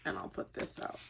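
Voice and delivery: near-whisper, very low and soft; muttered to self. Real, not acted. Reader laying out cards, muttering to self. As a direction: mutter it almost without voice.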